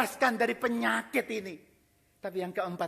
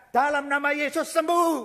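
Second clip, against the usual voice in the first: commanding healing; 7 semitones higher, 8 dB louder than usual. Pretend. Prophet's hoped-for healing command.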